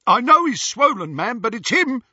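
high, loud eager thug voice; piping Snatcher voice. A narrator's reading. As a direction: pipe up eagerly. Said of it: "piped up Scabia".

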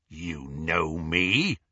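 low, slow resentful werewolf growl. A narrator's reading. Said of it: "resentment in the werewolf's voice".

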